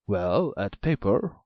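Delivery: the ogre grunts an order, slowly; an ogre talks to the maiden, slow and loud. A narrator's reading. Ogre answers Jamila.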